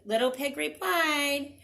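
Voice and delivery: ordinary delivery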